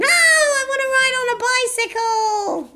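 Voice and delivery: very high, sing-song bird voice; squeaky excited eagle. Acted. Eagle voice again.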